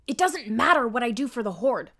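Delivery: harsh, nervous catlike snap; harsh insecure Catra voice. Acted. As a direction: insecure rival lashing out. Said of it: "she speaks harsher because she's insecure".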